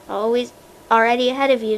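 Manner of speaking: usual speaking voice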